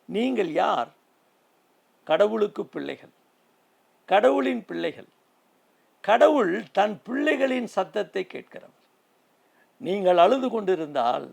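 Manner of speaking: rousing preaching. Acted. Preacher assuring listeners that God hears their cry, rousing. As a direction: lift the congregation with assurance.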